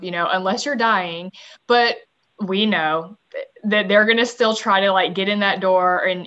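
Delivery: ordinary talk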